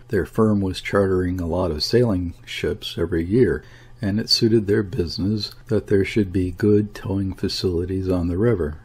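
ordinary delivery

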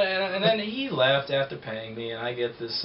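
usual speaking voice

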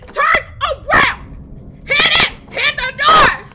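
high, loud, slow frantic yelling; panicked shout. Real, not acted. Gamer screaming at herself while the monster chases. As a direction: scream orders while fleeing.

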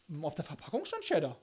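ordinary delivery

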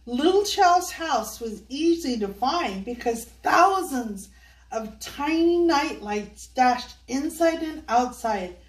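ordinary delivery

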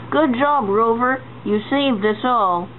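ordinary delivery

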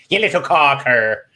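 slow, put-on Irish accent; mock Irish accent. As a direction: draw it out in a playful Irish accent. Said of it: "Work on my Irish accent".

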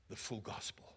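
breathy whisper for emphasis; hushed aside to the congregation. Real, not acted. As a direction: drop to a whisper on the key words. Preacher drops to a whisper.

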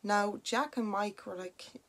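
usual speaking voice

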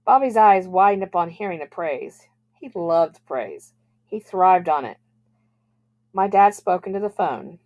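usual speaking voice

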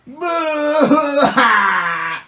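high, loud fake bawl; big playful sob cry; mock crying. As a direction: pretend wail, taking a turn at crying. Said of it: "we were all crying for mommy".

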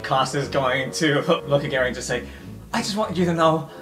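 ordinary delivery

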